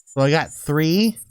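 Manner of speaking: ordinary talk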